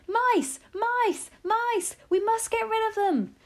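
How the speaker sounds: very high alarmed cries; panicked yelling. A narrator's reading. Everyone in the dining room spots mice, yelling in panic. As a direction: yell it high, raising the alarm. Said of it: "everyone was yelling".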